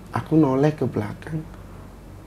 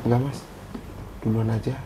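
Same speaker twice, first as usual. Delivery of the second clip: low, softened retold answer; imitated whispery reply; 3 semitones lower, 9 dB quieter than usual. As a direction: drop it down to a faint reply. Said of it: "Tapi dibisikin". (menirukan jawaban pelan seperti berbisik)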